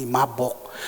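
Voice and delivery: ordinary talk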